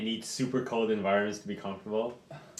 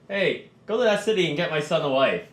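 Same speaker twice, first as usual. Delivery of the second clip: loud, higher mock command; bossy noble; 6 semitones higher, 7 dB louder than usual. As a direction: order a servant about, half-joking. Player mimics noble ordering a servant.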